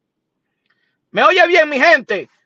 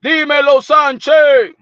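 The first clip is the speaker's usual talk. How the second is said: hyped shout-out; 6 semitones higher, 8 dB louder than usual. Acted. Hyping up Mister Sanchez for his donation, high and loud. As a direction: belt out a higher, louder hype greeting. Greets supporter in put-on voice.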